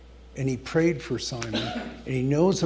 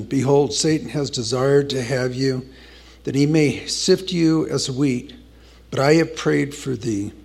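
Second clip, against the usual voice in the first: louder, proclaiming preacher's scripture read; forceful scripture reading; 6 dB louder than usual. Acted. Preacher reading the Lord's words to Peter, proclaiming. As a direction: declaim the verse to the congregation. Reads scripture aloud in sermon.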